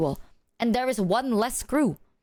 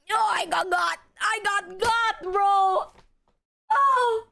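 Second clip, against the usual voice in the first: high wail; 8 semitones higher, much slower than usual. Real, not acted. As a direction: wail like you've been caught. Caught by therapist, wailing.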